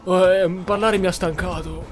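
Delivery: ordinary talk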